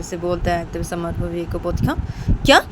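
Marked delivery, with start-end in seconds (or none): 1.8-2.6 higher, halting questions; indignant protest. A narrator's reading. Student protests being called only a student, indignant. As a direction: object in disbelief, pushing harder at the end.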